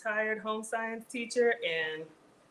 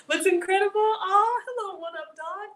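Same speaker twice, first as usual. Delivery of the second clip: much higher and louder, gushing over the compliment; light up with delight at the praise; excited gush; 11 semitones higher, 6 dB louder than usual. Real, not acted. Excited reaction to viewer's comment.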